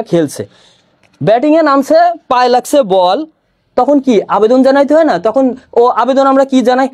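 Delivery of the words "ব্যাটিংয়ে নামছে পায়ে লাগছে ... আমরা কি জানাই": ordinary talk, nothing marked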